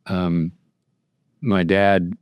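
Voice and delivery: normal talking voice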